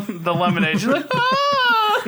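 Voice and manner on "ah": high-pitched wail imitating an upset girl; short, hammed-up high cry; mock girl's cry. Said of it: "The girl cries when he drinks".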